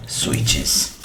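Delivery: usual speaking voice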